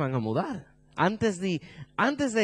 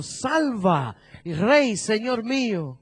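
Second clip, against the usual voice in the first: raised, moderately loud plea to the king; crying out; 5 semitones higher, 4 dB louder, much slower than usual. Acted. Woman begging the king of Israel, mildly shouted cry. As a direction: call out for help as in a dramatic reading. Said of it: "una mujer le gritó y dijo".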